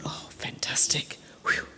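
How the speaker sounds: acted whispered aside to oneself; hushed delight. Acted private thought on getting envelope.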